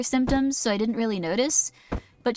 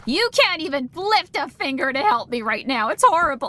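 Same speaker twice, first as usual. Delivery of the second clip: louder self-pitying complaint, hammed up; mock martyr; 2 semitones higher, 4 dB louder than usual. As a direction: play the put-upon martyr, overdone.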